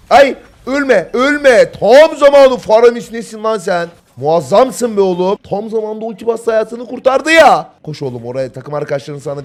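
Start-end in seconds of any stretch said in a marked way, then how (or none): 0.1-7.7 high, loud, stretched-out exclamations; thrilled shouting. Real, not acted. Caster cheers a player saved by a timely ulti, thrilled shouting. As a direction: yell praise in disbelief at the save. Excited at a last-second save.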